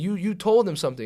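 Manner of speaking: loud, high re-enacted protest; retold accusation. Player accuses coach of telling, retold heated accusation. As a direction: accuse him as in the argument.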